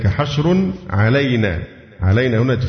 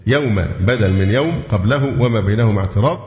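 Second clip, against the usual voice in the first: ordinary talk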